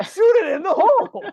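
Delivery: very high, loud hammed-up shout; mock coach yell. Coach to a basketball player, mock yelling. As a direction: holler it absurdly, breaking into a laugh.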